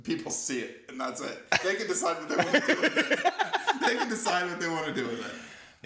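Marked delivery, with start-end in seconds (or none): 1.6-5.9 high, loud words through laughter; cracking up. Real, not acted. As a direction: laugh helplessly while talking. Breaks up laughing mid-sentence.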